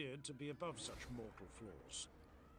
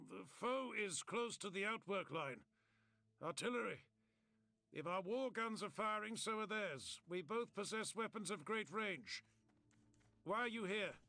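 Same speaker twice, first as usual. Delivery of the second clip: raised, higher urgent battlefield warning; urgent soldier report; 6 semitones higher, 4 dB louder than usual. A narrator's reading. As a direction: bark out the report to an officer, pressed and loud. Soldier reporting under artillery fire.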